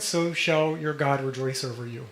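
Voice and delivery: normal talking voice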